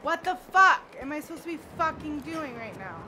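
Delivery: loud frustrated gamer rant; real anger. Real, not acted. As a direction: demand an answer, fed up with the game. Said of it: "I'm so mad".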